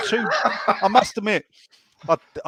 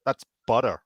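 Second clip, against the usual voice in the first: low-pitched imitation American accent; deadpan American woman, lightly imitated accent; 6 semitones lower than usual. American host correcting the guest about the ice cream, American accent. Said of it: "we were staying with American people".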